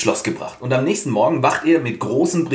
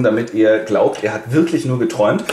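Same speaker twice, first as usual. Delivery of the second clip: normal talking voice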